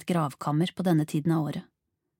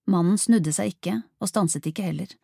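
usual speaking voice

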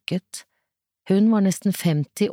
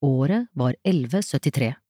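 plain reading voice

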